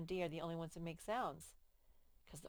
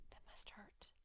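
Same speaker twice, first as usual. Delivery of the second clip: whisper; 21 dB quieter than usual. Real, not acted. Aside to child.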